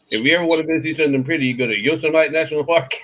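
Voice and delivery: quick teacher quote. Retold or imitated. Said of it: "And she said".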